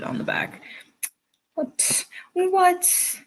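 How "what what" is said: high incredulous what; very high disbelieving what; shocked what. Real, not acted. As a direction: yelp it in disbelief. Shocked reaction to teacher.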